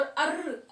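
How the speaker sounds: अर्र with a drawn-out rolled r; teacher demonstrating अर्र; rolled r sound. Shown as a demonstration. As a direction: stretch the r in अर्र. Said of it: "अर्र के रूप में होता है".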